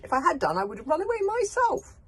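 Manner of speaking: high loud reasoning call. Acted. Boy argues down with the villain. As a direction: argue it up from the mast. Hiccup calling down, arguing.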